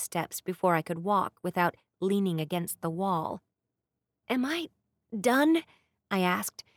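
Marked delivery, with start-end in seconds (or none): 4.3-5.6 whiny. A narrator's reading. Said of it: "unable to keep a whine out of my voice".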